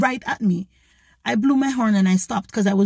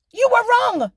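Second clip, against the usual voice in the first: indignant insistence; 4 dB louder than usual. Retold or imitated. Final insistence to driver.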